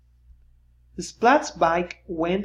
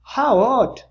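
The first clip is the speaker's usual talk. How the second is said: pitched-up puzzled cat voice; Splat's line; 6 semitones higher, 6 dB louder than usual. A narrator's reading. Cat reading to kids, puzzled and raised. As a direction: read it as a surprised cartoon cat. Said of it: "said Splat".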